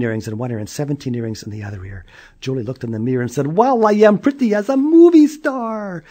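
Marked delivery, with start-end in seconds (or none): 3.6-6.0 high, loud admiring voice; vain girl. Acted. Julie admiring herself in the mirror, high and loud. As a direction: preen at yourself.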